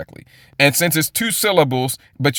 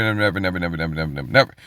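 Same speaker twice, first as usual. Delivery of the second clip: fading never; 11 semitones lower, 5 dB quieter, much faster than usual. Pretend. Mocking eternal hell doctrine.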